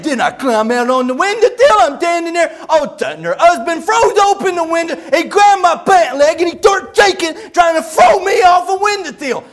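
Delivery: high, loud agitated telling; worked-up mock shouting, words garbled; Buddy. Buddy tells of being ordered onto the windowsill, shouting. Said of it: "when he gets real worked up".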